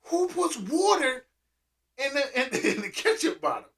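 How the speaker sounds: can't believe it; disbelief. Retold or imitated. Kid amazed at watering ketchup, incredulous. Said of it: "I'm sitting there like".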